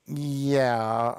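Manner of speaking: ordinary delivery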